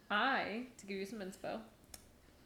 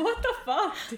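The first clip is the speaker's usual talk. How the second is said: high indignant protest through laughter; hammed-up outrage with a laugh in it; laughing mock outrage; 9 semitones higher than usual. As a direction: play offended while cracking up. Joking outrage at retold boss.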